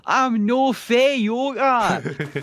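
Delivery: high, drawn-out Scottish holler; outburst. Retold or imitated. Man bursting into a barber's shop, drawn-out bellow. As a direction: bellow it slow and high in broad Scots. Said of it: "bursting into a barber's shop".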